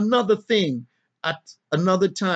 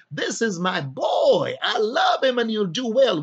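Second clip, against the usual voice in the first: God the Father proclaiming; 5 semitones higher than usual. Acted. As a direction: proclaim it from heaven over your son. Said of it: "Yet and still his father saying".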